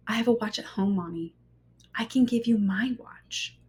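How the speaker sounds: put-on a small whispered child's offer; whispering little daughter. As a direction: breathe the words like a shy little girl. Daughter offers her own watch.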